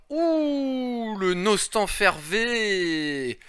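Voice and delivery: high drawn-out ooh of delight; delighted gasp. Real, not acted. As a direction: savor the card name slowly. Delighted at rare card.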